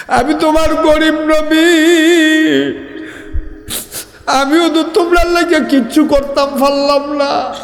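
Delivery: tearful lament. Acted. Poor companion's lament to the Prophet.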